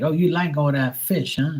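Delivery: high-pitched mock Boston accent. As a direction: imitate a chatty Bostonian. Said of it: "they got that harsh accent".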